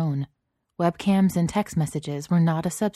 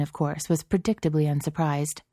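ordinary delivery

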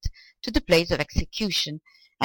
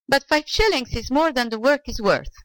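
put-on arguing, higher-pitched old man; higher, mildly argumentative objection; 7 semitones higher than usual. A narrator's reading. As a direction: mildly protest in a raised pitch. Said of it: "argued the old man".

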